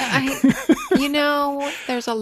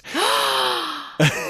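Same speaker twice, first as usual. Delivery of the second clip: shocked gasp; 4 semitones higher than usual. Real, not acted. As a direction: gasp in surprise. Shocked at Kevin's snark.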